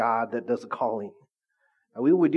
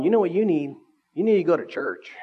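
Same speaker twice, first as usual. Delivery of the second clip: pushy preachy tone; 4 semitones higher, 4 dB louder than usual. Retold or imitated. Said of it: "It's like attitude".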